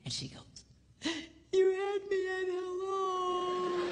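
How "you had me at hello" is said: swooning movie line. Retold or imitated. Wife's reply in the movie.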